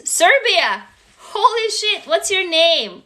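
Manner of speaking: high-pitched delighted astonishment; amazed shout-out. Real, not acted. Host amazed at a viewer from Serbia, excited surprise. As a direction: react big, pitch jumping up.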